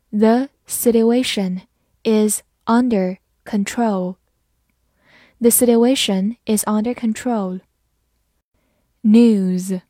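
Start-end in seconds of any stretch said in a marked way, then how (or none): 0.1-4.2 slow, careful model reading. Shown as a demonstration. Teacher slowly reading the example sentence. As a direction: read it slowly for learners. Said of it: "好，慢读一遍".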